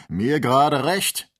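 loud, slow, defiant retort; embittered. A narrator's reading. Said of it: "versetzte der Jäger erbittert". (erbittert und trotzig)

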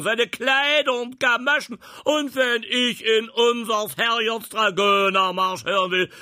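furious shouting field marshal, high and loud; Zornesschrei. Acted. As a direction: scream it in highest rage. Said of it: "schrie in höchstem Zorne". (in höchstem Zorn schreiend)

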